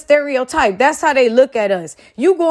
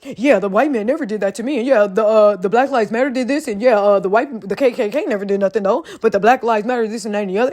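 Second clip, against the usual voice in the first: lower, mocking parody of the man; lightly voiced sneering mimicry; mocking impression; 4 semitones lower than usual. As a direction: parody his words with disdain. Mocking the man's claims.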